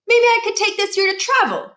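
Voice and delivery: put-on a boy selling his plan to mom; eager young Miles. A narrator's reading. Miles pitching ideas to mom.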